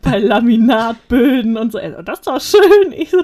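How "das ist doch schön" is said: mock delighted Dylan. Dylan admiring laminate in store.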